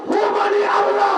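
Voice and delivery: high, impassioned preaching cry; preacher voicing a scholar's answer, high and impassioned; fiery preaching. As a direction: preach it at full force to the crowd. Preaching at full force.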